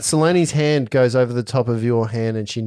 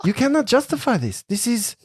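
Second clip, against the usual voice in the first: indignant NPC; 6 semitones higher than usual. Acted. NPC condemns evil spell.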